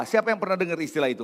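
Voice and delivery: ordinary talk, nothing marked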